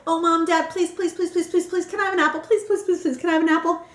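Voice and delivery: put-on rapid, repetitive childish pleading; mock begging. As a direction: plead like a kid pestering parents. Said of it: "beg, beg, beg".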